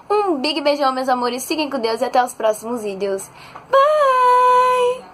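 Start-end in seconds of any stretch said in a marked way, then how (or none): 3.7-5.1 high, loud, stretched-out bye; despedida animada. Real, not acted. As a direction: sing out a big sign-off. Signing off to viewers. (tchau agudo e esticado)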